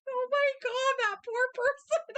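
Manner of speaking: high squealing pity; mock shocked squeal. Retold shocked reaction to name.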